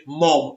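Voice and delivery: quoted "Mom" dropped low and drawn out; a scornful quote of the American "Mom"; mocking American mom. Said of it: "she's just said".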